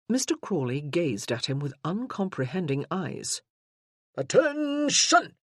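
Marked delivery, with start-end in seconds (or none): 4.1-5.4 much higher, louder drawn-out bark; drill command. Acted. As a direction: bellow it across a parade ground. Sergeant barking an order.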